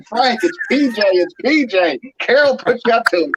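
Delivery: high-pitched mock yell, only normally loud; imitated frantic shouting to be recognized; retold scream. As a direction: plead urgently over his rant, amused. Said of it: "I'm screaming as loud as I can".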